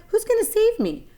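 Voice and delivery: very high, eager plea; excited. Acted. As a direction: ask in breathless hope. Wilbur pleads for rescue.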